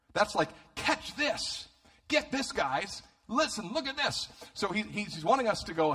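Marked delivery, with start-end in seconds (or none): 0.8-4.3 high, hushed-edged "get this" aside; urgent attention-grabbing. Acted. Luke wanting readers to look, high and urgent.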